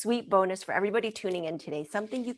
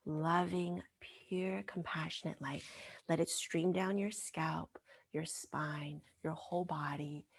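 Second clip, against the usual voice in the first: guided visualization; 5 dB quieter than usual. Retold or imitated. Healer whispers a guided visualization to a crying woman. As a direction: lull her into the light.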